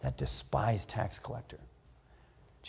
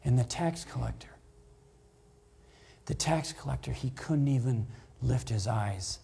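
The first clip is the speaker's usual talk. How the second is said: hushed and humble. Shown as a demonstration. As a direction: let it sink to a whisper. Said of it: "Or was it like this?".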